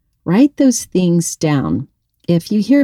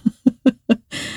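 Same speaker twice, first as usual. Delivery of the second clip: light laugh; 6 semitones higher, 3 dB quieter than usual. Real, not acted. Laughs at own silliness.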